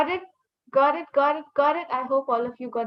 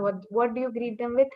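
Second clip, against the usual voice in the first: ordinary talk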